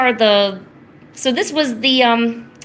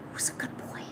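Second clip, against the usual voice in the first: hushed, breath-only whisper; very soft whispered coo; whispered pet praise; 17 dB quieter than usual. Real, not acted. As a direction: whisper sweetly to your pet.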